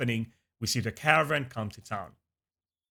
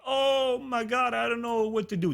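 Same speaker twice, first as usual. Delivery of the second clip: mock panic; 10 semitones higher than usual. Ewok stuck in the pond cries for help, mock panic. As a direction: stretch it out like a helpless little girl.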